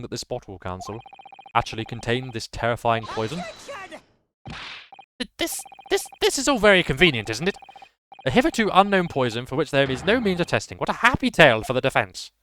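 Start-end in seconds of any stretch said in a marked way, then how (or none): 5.2-12.3 higher, louder pompous prosecutor; Ouchi voice. Acted. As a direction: bluster like a self-important prosecutor. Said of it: "doing his voice".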